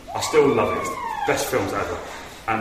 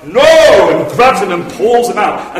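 loud cry of rescue; 8 semitones higher, 15 dB louder, slower than usual. Retold or imitated. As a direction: shout it out, much louder. Sam rescues Frodo, shouting.

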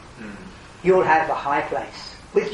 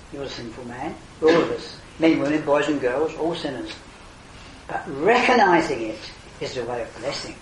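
usual speaking voice

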